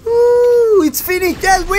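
high triumphant outburst; elated cheering. Real, not acted. Gamer celebrating that it's finished, high elated cheer. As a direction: whoop high as the match ends.

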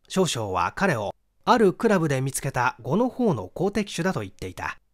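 plain reading voice

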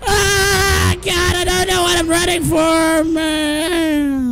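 high, sustained wail while fleeing; panicked wail. Real, not acted. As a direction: cry out high in a panic, barely pausing.

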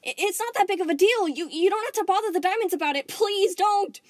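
very high, loud, pleading; frantic begging. Acted. Spinel begging Steven not to tell the Diamonds, frantic. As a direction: beg him not to go. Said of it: "getting more frantic".

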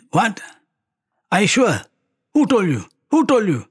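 high-pitched disbelieving questions; urgent, incredulous questioning, voice jumping high; retold shock. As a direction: act out stunned disbelief, pitched well up. Retold shocked reaction on the phone.

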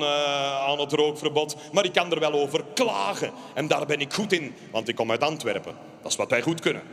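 ordinary talk

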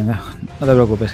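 ordinary delivery

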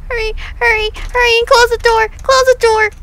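fleeing in panic; panicked hurry. Real, not acted. Fleeing back into the house.

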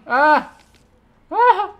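high, loud short screams; panicked scream. Real, not acted. Gamer screaming while fleeing the mobs. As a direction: yelp while running away.

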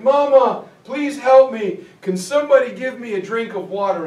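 wounded soldier begging for his mother; dying soldier's plea. Retold or imitated. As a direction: cry out for help.

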